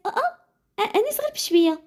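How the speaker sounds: high-pitched startled "oh, oh". A narrator's reading. Woman teacher warning Anis to go easy, startled. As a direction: exclaim up high, alarmed for a colleague.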